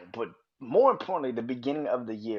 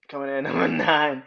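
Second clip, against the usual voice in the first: laughing, louder; 3 semitones higher, 6 dB louder, faster than usual. Shown as a demonstration. Host retakes his list intro, laughing. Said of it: "Oh, shit".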